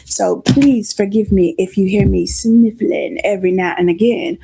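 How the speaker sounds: blocked nose, low and stuffy; congested. Real, not acted. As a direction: talk through a stuffy nose. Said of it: "I'm a little congested".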